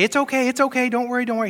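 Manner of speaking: a touch of hammed-up reassurance; mock reassurance. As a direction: imitate a soothing fusser, lightly. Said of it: "he doesn't say".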